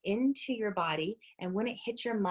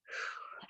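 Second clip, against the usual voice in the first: soft, breathy exhale; empty the lungs slowly; audible breath out; 12 dB quieter than usual. Shown as a demonstration. Instructor demonstrating breathing to her class, soft exhale. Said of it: "In through your nose".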